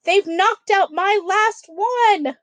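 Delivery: high, slow horrified wail; horrified shriek; shrieking dismay. Acted. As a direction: cry it out in panic. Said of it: "George shrieked".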